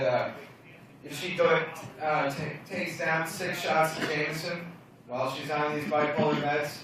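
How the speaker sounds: ordinary talk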